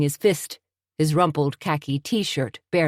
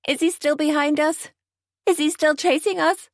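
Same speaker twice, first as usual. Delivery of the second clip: much higher, panicked questions; frightened scream; 12 semitones higher than usual. A narrator's reading. Tony hiding her eyes in the speeding car, panicked scream.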